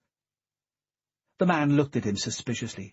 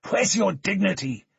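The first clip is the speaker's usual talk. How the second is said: higher, louder angry challenge; 9 semitones higher, 5 dB louder than usual. Acted. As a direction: throw the question at him in anger. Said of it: "full of anger".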